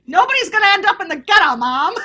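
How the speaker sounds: put-on fast, loud old Bowery gangster accent; thirties gangster accent. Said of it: "That's how you talked in the thirties".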